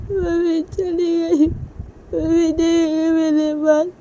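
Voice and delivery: put-on high, loud sobbing child with stretched words; loud crying. Liya crying to papa that mummy has gone, loud wailing. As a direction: wail it out slowly, high and tearful. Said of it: "लिया बहुत ज़ोर से रोने लगती है".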